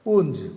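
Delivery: "ఊంజ్" with a voiced z-like ending; mistaken pronunciation. Shown as a demonstration. Teacher tests a form then rejects it, voiced ending. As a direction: buzz the final s of "ఊంజ్". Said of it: "కాదు".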